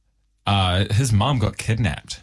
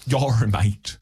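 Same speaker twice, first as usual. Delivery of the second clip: hammed-up South African threat; South African accent; 3 semitones higher than usual. Local threatens a newcomer over his turf, in a South African accent. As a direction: play a menacing local in mock South African. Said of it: "South African accent".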